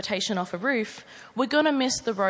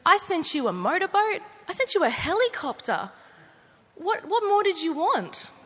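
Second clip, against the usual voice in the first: Aussie God; 7 semitones higher, 4 dB louder than usual. Acted. God answering the drowned man, exasperated, in an Australian accent. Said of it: "who's an Aussie in this version".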